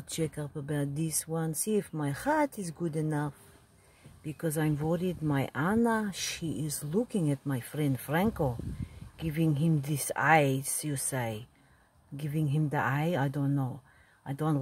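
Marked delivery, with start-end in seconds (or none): none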